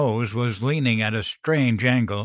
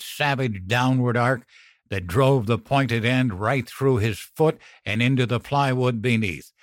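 plain reading voice

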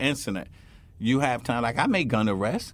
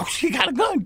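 much higher, alarmed cry; suddenly spot the gun and yell; retold alarm, shouted high; startled shout; 3 dB louder than usual. Retelling sudden gun discovery.

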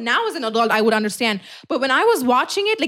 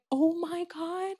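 softer, slow, higher-pitched realisation; retold hushed shock; 5 semitones higher, 10 dB quieter, slower than usual. Girl explained it to me in group chat, retold hushed shock. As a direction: let it sink in quietly. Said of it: "explained it to me and I'm like".

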